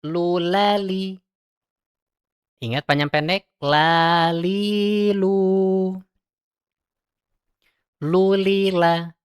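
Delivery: slow drawn-out Lu, lali; practice syllables. Shown as a demonstration. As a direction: drill Lu, lali slowly and high. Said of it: "Yuk kita praktekkan". (latihan lambat lu lali)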